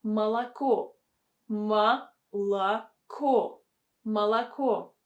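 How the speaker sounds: "Молоко" said ma-la-KO, whole, then by syllable, then whole. Shown as a demonstration. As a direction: say "Молоко" with two A's and a stressed final O. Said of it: "be pronounced with the sound A".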